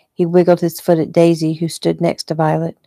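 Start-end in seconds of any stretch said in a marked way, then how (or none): none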